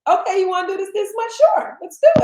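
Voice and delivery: high, loud, quick gung-ho voice; excited high-pitched yes; eager agreement. Retold or imitated. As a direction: imitate followers jumping right in.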